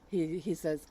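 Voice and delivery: usual speaking voice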